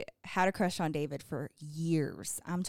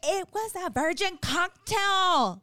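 exasperated defense; 10 semitones higher, 4 dB louder, slower than usual. Retold or imitated. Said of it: "I was like".

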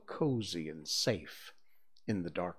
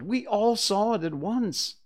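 put-on high excited children; pitched-up eager chorus; eager cry; 9 semitones higher, 4 dB louder than usual. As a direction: shout it eagerly, higher and louder. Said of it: "they all cried".

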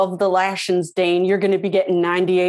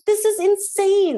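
high incredulous voice; 13 semitones higher, slower than usual. Retold or imitated. Own reaction acted out.

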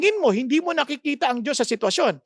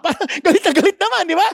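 laughing mockery; 5 semitones higher, 4 dB louder, much faster than usual. Laughs mocking hidden anger.

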